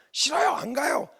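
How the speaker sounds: high-pitched mimicked refusal; put-on defiant son; bratty refusal. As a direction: refuse in a high, childish mock voice. Son's refusal mimicked again.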